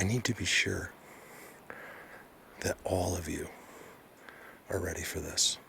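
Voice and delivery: quiet. Real, not acted. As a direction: hold it back, almost private. Teasing viewers before the reveal.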